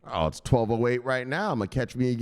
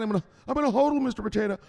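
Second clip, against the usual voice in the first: high-pitched pupil's answer; higher put-on voice; dutiful follower; 6 semitones higher, faster than usual. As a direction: play the follower reciting on demand.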